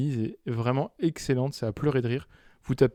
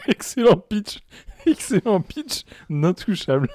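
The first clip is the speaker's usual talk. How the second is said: high, loud, slowed laughing words; 6 semitones higher, 4 dB louder, slower than usual. Real, not acted. Host laughing at a bad film pitch, high helpless laughter. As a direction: crack up while getting the words out.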